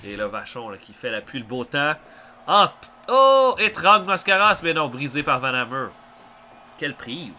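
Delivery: lively wrestling play-by-play; wrestling commentator. Acted. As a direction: call the match live.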